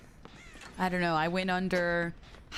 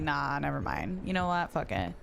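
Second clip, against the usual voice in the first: dismissive shrug; 7 semitones lower than usual. Retold or imitated. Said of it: "you come back and you're like".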